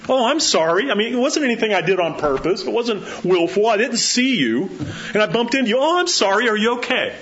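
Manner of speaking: high, quick flustered sorry; high flustered bump apology; startled apology. Acted. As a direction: apologize surprised and concerned. Acting an accidental bump.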